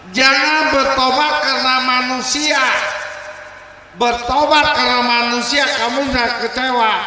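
high, loud stern preaching; stern advice. Acted. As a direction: lecture him firmly from above. Village head advising the sinner. (menasihati dengan tegas dan lantang)

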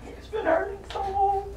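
real tears of relief for a loved one; tearful. Real, not acted. Wife tearful watching husband's relief.

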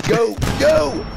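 high, loud urging; urgent shouting. Real, not acted. Gamer urging a teammate onward, urgent shout. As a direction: yell to spur them on.

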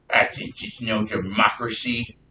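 slow, drawn-out mock lecturing historian. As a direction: imitate a smug revisionist historian. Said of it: "They say it in this exact tone".

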